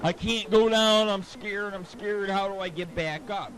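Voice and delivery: high, loud, whiny imitation of sobbing; mock crying Dave. Mocking friend stuck on a cliff, loud mock crying. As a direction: wail it like a frightened grown man. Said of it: "Dave started crying".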